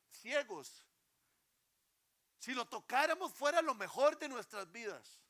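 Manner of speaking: preacher rising to fervour; impassioned preaching. Acted. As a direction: lift the congregation with longing. Preaching climax.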